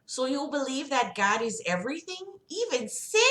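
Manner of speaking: high, singsong doctor voice; Sarah Palin. Acted. Doctor questioning her patient about God, high put-on voice. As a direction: pitch it well up, lilting questions. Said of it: "We'll just do Sarah Palin".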